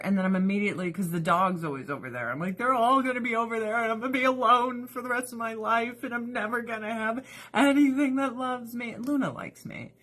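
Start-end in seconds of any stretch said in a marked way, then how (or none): 2.6-8.9 fast, mock-whiny self-pity; self-pitying outburst. Wife replaying her panic about the kittens, fast mock whine. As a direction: rush it out like a pouty wail.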